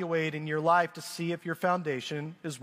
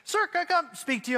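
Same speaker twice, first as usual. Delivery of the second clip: higher, quick polite request; lightly voiced worker; 7 semitones higher, faster than usual. Pool worker asking homeowner for a word, politely ominous. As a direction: lightly imitate a worker with bad news coming. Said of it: "addresses you like that".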